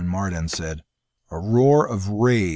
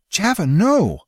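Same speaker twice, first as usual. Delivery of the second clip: high, loud desperate cry; 11 semitones higher, 5 dB louder, slower than usual. A narrator's reading. Bella begging her brother not to go, crying out. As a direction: cry out to stop him. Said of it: "Bella cried".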